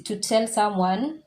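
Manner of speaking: ordinary talk, nothing marked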